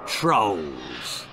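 low, rumbling troll voice. A narrator's reading. Troll mother answering the boy, low and menacing. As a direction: answer like a monster.

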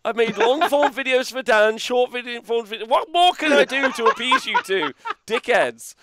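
high-pitched, hammed-up wounded protest; mock exasperation. Mock complaint at teasing co-hosts.

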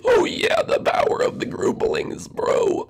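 high squeal into voiceless whisper; whispery little character. Pretend. Sidekick praises the grouplings' work, whispery voice. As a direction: start high, then whisper it in awe. Figure cheers the builders.

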